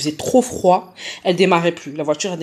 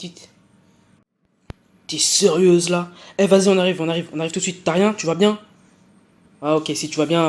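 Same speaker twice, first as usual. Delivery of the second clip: normal talking voice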